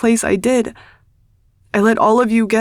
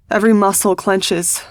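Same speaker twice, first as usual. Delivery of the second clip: plain reading voice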